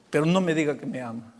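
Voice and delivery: suddenly low and soft; hurt plea. Retold or imitated. Boy begs pastor not to claim love, quietly hurt. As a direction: drop to a quiet, wounded request. Boy cannot bear hearing he's loved.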